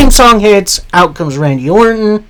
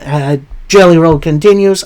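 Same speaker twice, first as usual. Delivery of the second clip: ordinary delivery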